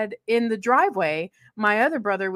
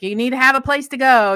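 loud, higher stern warning; scolding staff; 6 semitones higher, 9 dB louder than usual. Retold or imitated. School staff scolding a kid hanging around outside, loud and stern.

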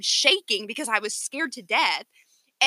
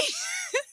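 helpless high giggle; breathless laughing fit; high squealing laugh; 6 dB quieter than usual. Real, not acted. Laughing at her sister's retelling, squealing. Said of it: "okay, Dory laughs".

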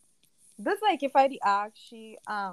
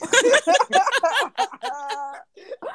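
loud, high burst of laughter; 10 semitones higher, 9 dB louder than usual. Real, not acted. Embarrassed that everyone talks about her, loud laughter. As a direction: crack up loudly.

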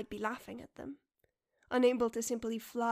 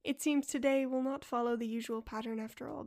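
usual speaking voice

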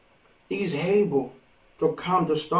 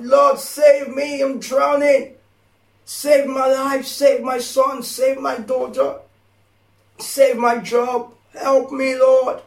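desperate cry to God; 5 semitones higher, slower than usual. Shown as a demonstration. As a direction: cry out to the Lord like you're drowning. Preacher acts desperate prayer.

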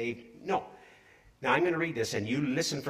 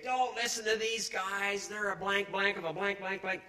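jeering heckler; 5 semitones higher, 4 dB quieter than usual. Retold or imitated. Drunk heckler Alan Sharp cursing at the team, mocked. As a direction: jeer at the crowd from across the street. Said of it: "And he would say".

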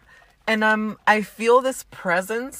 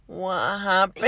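slow, raspy and half-awake; raspy sleepy voice; slower than usual. Real, not acted. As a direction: croak it out just waking up. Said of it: "her raspy voice, like, barely waking up".

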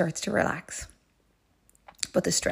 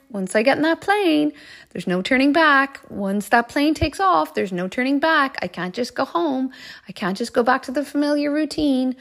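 higher, louder, mounting worry; anxious inner voice; 5 semitones higher, 4 dB louder than usual. Retold or imitated. Traveller's inner voice at the departure gate, anxiously insistent. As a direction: talk yourself urgently out of going. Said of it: "listening to that voice".

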